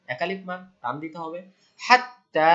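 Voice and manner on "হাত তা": তা stretched and loud after হাত; long standing fatha. Shown as a demonstration. Teacher correcting students, stretching তা loud for খাড়া জবর. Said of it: "খাড়া জবর".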